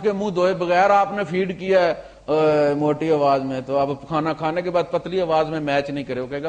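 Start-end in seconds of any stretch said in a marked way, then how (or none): none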